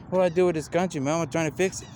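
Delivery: mild mock of a frustrated president; Obama. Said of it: "Obama did".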